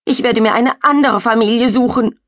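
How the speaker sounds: high, loud tearful wail; sobbing. Acted. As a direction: sob it out into the pillow. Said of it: "schluchzt sie".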